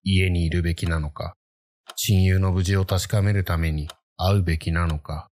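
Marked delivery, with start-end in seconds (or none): none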